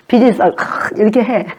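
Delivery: normal talking voice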